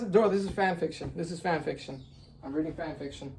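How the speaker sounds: hushed, dazed disbelief; stunned denial. Real, not acted. Reader tells himself this is fan fiction, stunned and hushed. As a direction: drop to a soft, stunned murmur.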